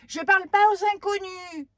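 high, loud sharp refusal; curt snap. Acted. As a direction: snap it at the stranger. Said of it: "répond sèchement la petite fille".

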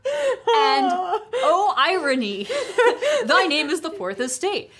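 laughing through the words; high laughing delivery. Real, not acted. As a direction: giggle through it at the irony. Laughing at the irony.

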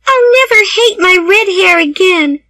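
put-on high, girlish young Anne; a worried girl pleading with Marilla; Anne's voice. A narrator's reading.

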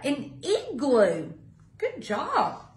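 plain reading voice

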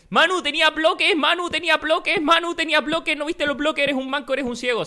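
high, teasing chant of the viewers; mocking commenters. Gamer imitating commenters mocking him, taunting voice. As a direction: mimic the trolls in the comments. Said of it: "todos los comentarios del vídeo van a ser".

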